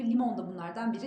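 normal talking voice

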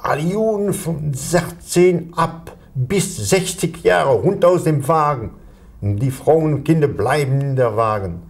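high, slow, barked command in German; German officer's order. Retold or imitated. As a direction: announce the order like a Nazi officer on the platform. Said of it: "l'officier qui dit".

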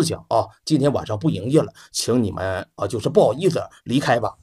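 low, soft polite waiter voice; hushed apologetic hotel waiter. Acted. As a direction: turn them away quietly and politely. Waiter politely turns guests away.

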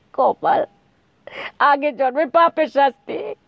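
tearful lament. Acted. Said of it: "বলতে গিয়ে এবার কেঁদেই ফেলল বীণা".

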